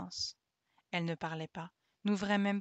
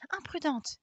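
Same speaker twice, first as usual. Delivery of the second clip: reproche d'enfant; 7 semitones higher, slower than usual. A narrator's reading. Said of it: "dit-elle".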